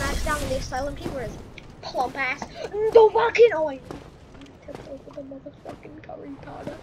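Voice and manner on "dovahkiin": loud, high war cry; scream. Shown as a demonstration. Gamer showing how to fight, shouted war cry. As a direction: scream it on each attack.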